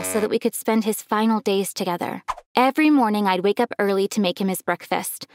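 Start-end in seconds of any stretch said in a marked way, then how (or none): none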